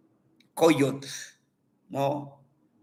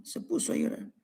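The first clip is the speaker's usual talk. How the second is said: whisper; 10 semitones higher, 11 dB quieter, much faster than usual. A narrator's reading. Granddaughter's crying told.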